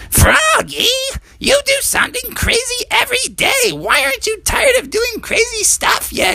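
very high squeaky mother voice; mom voice. Acted. Storyteller voicing Froggy's mom scolding him, squeaky high. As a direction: play Mom up high and exasperated.

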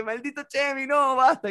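high, alarmed plea; frantic stop. Retold or imitated. Jason stopping Chemi from fighting, high alarmed. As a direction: pull him back, exasperated.

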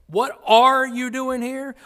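are punched loud and drawn out; slow, high question hammering the word are; stress on are. Shown as a demonstration. Preacher testing where the emphasis fell, stressing are loudly. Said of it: "where was the emphasis?".

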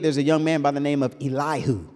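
usual speaking voice

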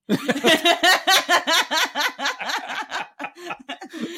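long high loud laugh; loud burst of laughter. Real, not acted. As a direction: crack up loudly at the teasing. Partner teasing her, she cracks up.

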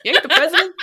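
high, loud, hammed-up retort; mock defiant yell. Riffing on the father quote, mock defiant yell. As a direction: throw it out big and loud.

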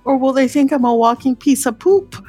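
ordinary talk

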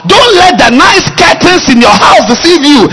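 high, loud preaching warning; shouted warning. Retold or imitated. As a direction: cry it out as a warning. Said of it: "My sister said".

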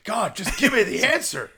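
loud, high mock-frustrated yell; exasperated outburst. Podcaster re-enacts a desperate quiz moment, exasperated. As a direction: demand it, acted at full volume.

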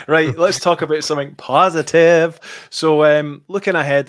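loud, bright pivot to good news; upbeat topic switch. Real, not acted. Host livening up the chat, loudly upbeat.

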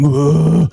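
loud, high mock whoa. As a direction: blurt a hammy whoa. Mock sound during sex.

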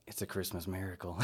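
hushed, amused wonder; softly, as if awestruck, with a laugh; mock awe. Joking about a church burning on Christmas Day, hushed mock awe. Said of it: "and I was like".

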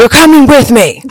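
high, loud mock man's threat; hammed-up menace, dragging everyone along; menacing mock threat. Man taking family with him.

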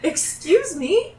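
slow, high-pitched disbelief; incredulous. Acted. Guard hears agony called a performance, incredulous. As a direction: draw it out high, affronted.

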